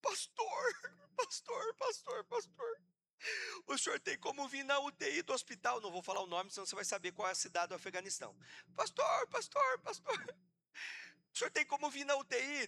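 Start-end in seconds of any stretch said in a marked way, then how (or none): 0.0-2.8 imitating a desperate woman in a very high wail; high-pitched frantic repeated pleas. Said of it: "Ela me liga desesperada". 3.7-5.8 imitated desperate caller, pitched higher; verzweifelte Bitte. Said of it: "Ela me liga desesperada". 8.7-10.4 imitating a desperate woman in a very high wail; high-pitched frantic repeated pleas. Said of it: "Ela me liga desesperada". 11.3-12.7 imitated desperate caller, pitched higher; verzweifelte Bitte. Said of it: "Ela me liga desesperada".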